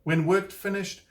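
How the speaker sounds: ordinary delivery, nothing marked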